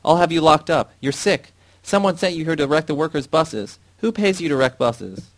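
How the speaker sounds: high, loud panicked shouting; shouting at the top of his voice. A narrator's reading. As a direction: scream threats while backing away. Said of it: "shouted at the top of his voice".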